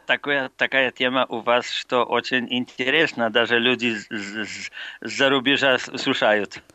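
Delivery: ordinary talk, nothing marked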